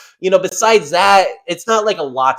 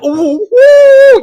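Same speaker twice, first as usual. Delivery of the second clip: high, loud fanboy whooping; mock excitement; 10 dB louder than usual. Host showing how he is not thrilled by this fight, mock-excited whoop. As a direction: squeal "ooh" in hammed-up excitement. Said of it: "all excited to watch this fight".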